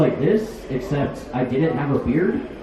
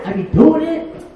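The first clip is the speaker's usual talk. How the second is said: incredulous question; 11 semitones higher, 5 dB louder, slower than usual. Retold or imitated. Wondering about the name.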